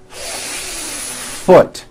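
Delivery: FOOT with the F hissed for a full beat first; long held F. Shown as a demonstration. Teacher showing the fix, long exaggerated F in FOOT. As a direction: stretch the airy F, then pop out FOOT. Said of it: "how long I am holding that F".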